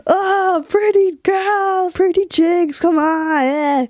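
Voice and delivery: high, drawn-out flirty croon; sing-song rock singer come-on; rock star purr. Retold or imitated. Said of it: "he says to us".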